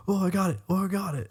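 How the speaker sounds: high-pitched "finally did it" exclamation; retold excitement. Podcaster recalling his breakthrough at practice, retold excitement. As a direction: replay a small triumph, pitch jumping up. Retelling finally managing the flex.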